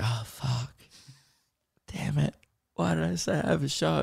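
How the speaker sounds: very soft, dismayed muttering; muttered self-reproach. Retold or imitated. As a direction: curse yourself under your breath. Re-enacts kicking himself inwardly.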